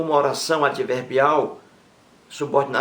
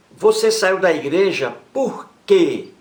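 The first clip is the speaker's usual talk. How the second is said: slow, louder read, leaning on "por quê" at the end; emphatic example reading; 5 semitones higher, 6 dB louder, slower than usual. Shown as a demonstration. Teacher reads the final "por quê" example emphatically. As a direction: read it out big for the class. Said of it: "o porquê está no final da pergunta".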